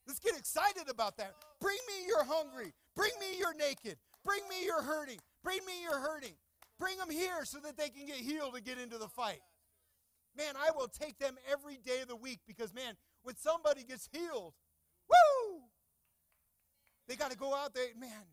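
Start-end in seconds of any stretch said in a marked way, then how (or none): 1.6-8.2 preaching. Real, not acted. Preaching at full force. 15.1-15.7 excited whoop. Real, not acted. Celebrating healing.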